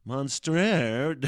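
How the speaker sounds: loud, hammed-up French accent on "Monstrer"; obnoxious mock French. Shown as a demonstration. Making fun of the French, in obnoxious mock French. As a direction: overdo the French like a dickhead. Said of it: "say it like a fucking dickhead".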